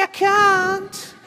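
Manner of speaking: very high, mournful puppet voice; Orville. Acted. Puppet bird answering his encouraging handler, high plaintive squeak. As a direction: refuse in a squeaky little bird's northern voice. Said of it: "he was slightly depressive".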